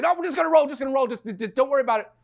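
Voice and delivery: high, loud, insistent director; frantic dismissal. Retold or imitated. Director to crew about to roll, frantically dismissive. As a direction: shout it away so filming can start. Director brushes off the odd behaviour.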